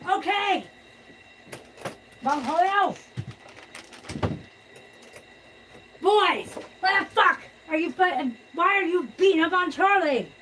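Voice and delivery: fed-up shout at the fighters; exasperated scolding. Real, not acted. Cats fighting again.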